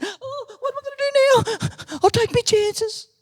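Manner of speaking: very high, tiny squeaky voice; little bird voice. Acted. Bird at the snake's throat plans escape, squeaky. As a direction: pipe up like a panicky little bird.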